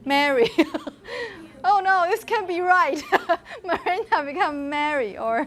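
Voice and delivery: real giggles breaking up the words; laughing. Real, not acted. Teacher finds her rule gives an absurd name, laughing. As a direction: dissolve into laughter at your own mistake. Teacher cracks up at own wrong result.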